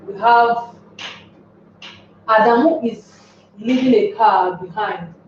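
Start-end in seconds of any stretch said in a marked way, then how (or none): none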